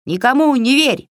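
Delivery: high, sharp cry of a minion; high-pitched yelled warning; cried out. A narrator's reading. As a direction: shout it out high, like a little goblin. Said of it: "выкрикнула Егоша".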